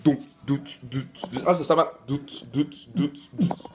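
rave beat sounds. Pretend.

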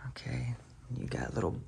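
hushed unvoiced aside; whisper. Real, not acted. Husband on house tour whispering to wife. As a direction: whisper it so the mic barely catches.

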